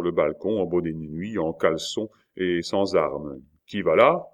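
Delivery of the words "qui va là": calling out. A narrator's reading. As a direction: call out to whoever is below. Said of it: "cria cet homme".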